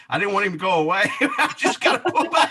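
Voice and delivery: talking through real laughter; giggling at her own story; laughing. Real, not acted. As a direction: laughs out the words, high and giddy. Laughing at the memory.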